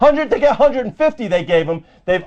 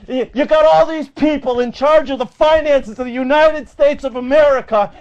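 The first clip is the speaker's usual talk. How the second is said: ordinary talk, nothing marked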